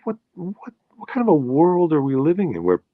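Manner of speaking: dismayed at the world; recalling a thought of indignant disbelief; incredulous thought. Retold or imitated. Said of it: "I thought".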